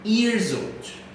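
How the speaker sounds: put-on Years old run together with a z sound; Years old linked, s voiced as z. Shown as a demonstration. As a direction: blend Years into old like yearzold. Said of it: "Quase que tem um som de Z aí, juntando as duas".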